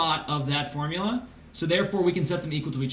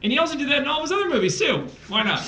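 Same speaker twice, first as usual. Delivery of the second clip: excited; 9 semitones higher, much faster than usual. Real, not acted. Mock exasperation about the movie.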